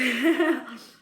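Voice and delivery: bright, loud burst of laughter. Real, not acted. Laughing after the boast.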